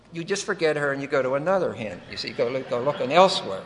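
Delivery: normal talking voice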